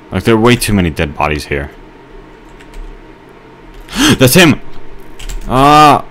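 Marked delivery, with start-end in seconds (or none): 3.9-4.6 gasp, then a high loud cry; alarmed high-pitched shout; startled alarm. Real, not acted. Spots the murderer in game. 5.5-6.0 high, loud startled scream; short shriek of alarm; panicked scream. Real, not acted. Screams at murderer in game.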